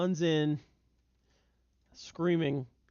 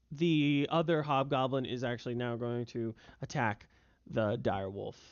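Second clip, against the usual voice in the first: ordinary talk, nothing marked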